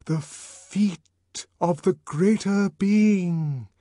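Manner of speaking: very slow, drawn-out stage whisper; hushed, piercing, suspense-laden whisper; penetrating whisper. A narrator's reading. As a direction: whisper it intensely, word by word. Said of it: "sunk to a whisper".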